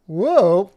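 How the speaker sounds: loud, quick swooping whoa; high, punchy whoosh of a whoa; zip sound effect. Pretend. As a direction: imitate something zipping in. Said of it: "like a zip".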